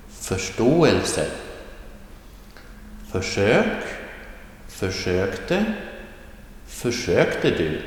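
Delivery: careful word drill, förstärkning rising from -stärk-; rising tone, second syllable. Shown as a demonstration. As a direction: lift the second syllable each time, förSVAR, förSTÅR, förSÖK. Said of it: "Listen to the pronunciation".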